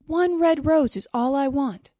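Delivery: high, loud, insistent plea; crying out. A narrator's reading. Nightingale pleading with the tree, crying out. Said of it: "cried the nightingale".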